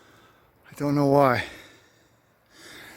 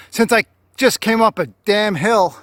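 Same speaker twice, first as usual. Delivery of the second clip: loud exclamation; 8 semitones higher, 8 dB louder than usual. Real, not acted. Exclaims about the hill.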